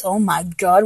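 ordinary delivery

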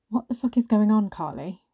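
loud bark, dropping on the name; angry bark. Acted. Colleague confronting Carly face up close, an angry bark. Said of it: "Nadia barked at me".

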